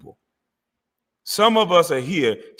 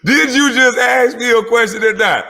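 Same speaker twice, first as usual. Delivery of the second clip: mocking; 8 semitones higher, 6 dB louder than usual. Commentator mimics the caller.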